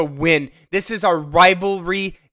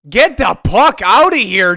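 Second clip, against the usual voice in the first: frantic shout; 4 semitones higher, 7 dB louder than usual. Real, not acted. Yelling at game.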